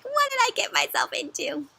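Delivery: high-pitched dismayed muttering; lightly voiced bear regretting it all; a hint of storybook dismay; dismayed Bruce. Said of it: "he's kinda like".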